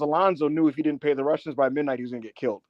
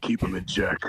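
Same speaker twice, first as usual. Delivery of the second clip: low, softer boss order; lowered-voice mimicked command; stern Hunter; 9 semitones lower, 6 dB quieter than usual. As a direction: imitate a boss giving a quiet instruction. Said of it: "And Hunter was like".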